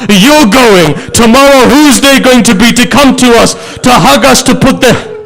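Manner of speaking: lament at full force. Acted. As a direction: cry the lament out, rising in pain. Wailing climax of the majlis.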